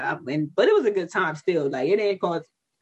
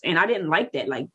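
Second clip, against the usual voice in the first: usual speaking voice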